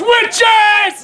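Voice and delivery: high, drawn-out call; theatrical cry. Acted. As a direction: cry out for them in hammed-up desperation. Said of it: "he cries".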